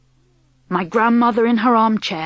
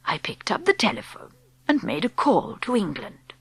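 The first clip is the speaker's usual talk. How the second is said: put-on calm whispery old woman explaining; old grandmother; 4 dB quieter than usual. Grandmother speaks.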